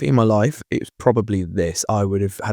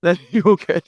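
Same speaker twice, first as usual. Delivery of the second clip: high voice broken by laughter; real laughter breaking into the words; laughing; 9 semitones higher than usual. Real, not acted. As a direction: reassure through a fit of giggles. Still laughing after the mum joke.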